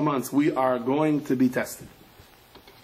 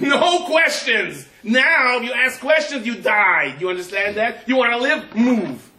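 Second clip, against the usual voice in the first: high, loud, slow angry bark; furious commander; 10 semitones higher, 6 dB louder, slower than usual. Acted. Commander shouting down a soldier under fire, loud and high. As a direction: yell the order, no arguing.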